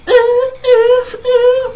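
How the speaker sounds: shrill high squealing ew; sassy smoke detector. Acted. Smoke detector squealing at the chef, high and disgusted.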